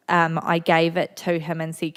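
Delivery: ordinary talk, nothing marked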